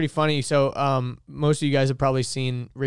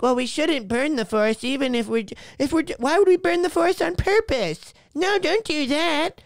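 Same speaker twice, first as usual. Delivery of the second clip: high-pitched whiny mimicry of objectors; mocking naive protesters; 10 semitones higher than usual. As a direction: voice clueless objectors, climbing much higher. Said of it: "are also the same people that".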